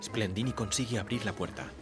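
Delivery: ordinary talk, nothing marked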